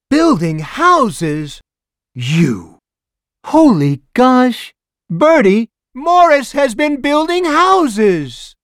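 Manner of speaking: high, loud, slow astonished busybody; high loud astonished cry; amazed neighbor. A narrator's reading. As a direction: marvel and shout the news to Bert. Neighbor amazed, calls to husband.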